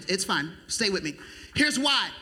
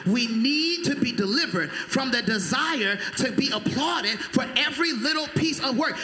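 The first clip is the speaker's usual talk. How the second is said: louder repeat; 6 semitones higher than usual. Shown as a demonstration. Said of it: "Let me say it again".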